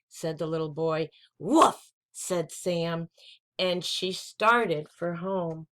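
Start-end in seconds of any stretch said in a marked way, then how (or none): 1.4-1.9 higher, louder yip; a quick goodbye bark; puppy woof. Acted. Puppy saying goodbye to the boy, yippy woof.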